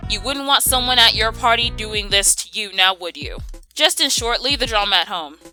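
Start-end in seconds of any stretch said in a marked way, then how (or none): none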